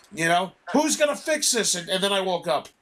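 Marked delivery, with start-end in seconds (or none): 0.7-1.8 high-pitched exasperated demand; mock scream for someone to take charge; retold screaming. Last outburst before waking from the dream, retold mock scream. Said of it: "I start screaming".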